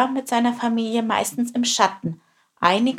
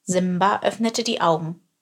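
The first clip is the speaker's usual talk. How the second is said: ordinary delivery, nothing marked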